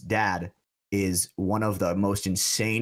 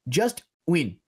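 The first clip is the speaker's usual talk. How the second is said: higher-pitched imitation French accent; 6 semitones higher than usual. French dad answering a driver's thanks, imitated French accent. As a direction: imitate a Frenchman's terse encouragement. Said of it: "in his French accent".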